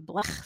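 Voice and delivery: "blech" ending in a throaty rasped ch; guttural ch sound. Shown as a demonstration. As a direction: say "blech" with a German-style ch, not a k. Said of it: "a ch sound".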